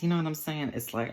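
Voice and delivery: normal talking voice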